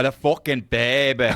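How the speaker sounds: loud, high mock British accent; imitating a hardcore British accent. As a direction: belt it like a thick-accented Brit. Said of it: "the most hardcore accent".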